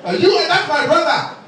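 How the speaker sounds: put-on high, indignant African-accented rebuff; hammed-up African man, pitched high. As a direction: disown him in a mock African accent. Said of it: "my brothers from Africa".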